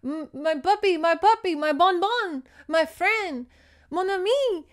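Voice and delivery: high, hammed-up wail of grief; mock screaming. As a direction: wail theatrically for a lost pet. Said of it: "she hears a woman screaming".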